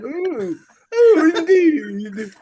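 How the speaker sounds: high, loud, drawn-out fake British; mock British accent. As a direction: ham up a posh British reply. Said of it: "do a fucking British ass accent".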